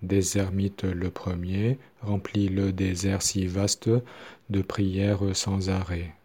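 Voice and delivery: slowly, syllable by syllable; measured recitation for learners; slow syllable-timed demo. Shown as a demonstration. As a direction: lay each syllable out evenly. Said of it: "c'est pareil pour".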